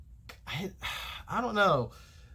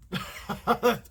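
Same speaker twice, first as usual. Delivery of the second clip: strained laugh; 8 semitones higher than usual. Real, not acted. Laughs after straining his throat.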